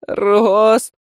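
high panicked shout of a name; hysterical call. Acted. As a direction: scream his name in panic. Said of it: "чуть ли не в истерике позвал Жан".

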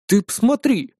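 a touch of grumpy surprise; grumbling. A narrator's reading. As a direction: grumble in mock indignation. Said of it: "пробурчал я".

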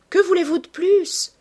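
high, loud exultant question; elated, ringing cry; musical cry. A narrator's reading. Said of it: "cria-t-elle musicalement".

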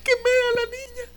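high sobbing cry; wife sobbing the news on the phone. Retold or imitated. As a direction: cry it out in panic. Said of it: "solo estaba llorando".

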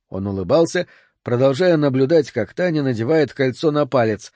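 ordinary delivery, nothing marked

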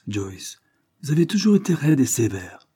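plain reading voice

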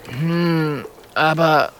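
doubtful, drawn-out hum; hesitant mumble. A narrator's reading. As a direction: object feebly, unsure. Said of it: "murmelt der kleine Feuerdrache".

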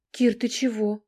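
worried wife lamenting, a touch of a wail. A narrator's reading. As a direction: fret over him, half wailing. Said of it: "запричитала Айка".

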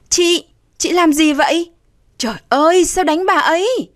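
high-pitched shocked protest; alarmed cry. A narrator's reading. Younger sister stopping her sister, alarmed. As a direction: cry out to stop the beating. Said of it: "nắm lấy tay chị gái kêu".

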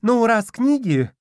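high, slow, trailing off; high and unsure, then cut off; faltering guard. A narrator's reading.

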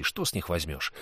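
ordinary delivery

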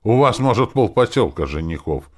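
querulous, rising in pitch and volume; peevish, nagging complaint; grumpy. A narrator's reading. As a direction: carp at them loudly. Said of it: "проговорил я сварливо".

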